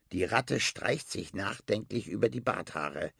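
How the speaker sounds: plain reading voice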